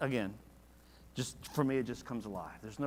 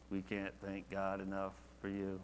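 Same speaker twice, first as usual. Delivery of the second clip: flat and dry; 6 semitones lower, 10 dB quieter than usual. Shown as a demonstration. Preacher mocking a lifeless reading of Paul, flat and quiet. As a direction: lower and softer, dull recitation. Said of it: "I don't see him just, you know".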